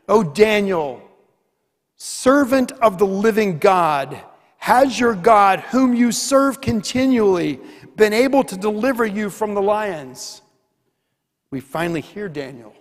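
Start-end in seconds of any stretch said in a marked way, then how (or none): 0.1-10.4 higher, louder, a king calling out in anguish; anguished cry. Acted. King calls into the lions' den, anguished loud cry. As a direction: cry out to Daniel in dread and hope. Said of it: "he cried out in a tone of anguish".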